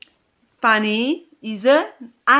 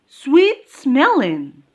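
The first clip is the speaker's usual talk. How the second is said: "sweet-smelling" slow and higher, weight on "smelling"; stress on SMELLING; 5 semitones higher, 4 dB louder, slower than usual. Shown as a demonstration. Teacher modelling adjective stress, "sweet-smelling" slowly stressed on "smelling". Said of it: "the stress goes in smelling".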